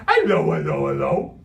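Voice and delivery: loud comic Cockney greeting; hammed-up Cockney, playful and loud; silly Cockney voice. A narrator's reading. Imaginary friend greets the stranger in the room, silly Cockney voice. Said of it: "in a silly Cockney voice".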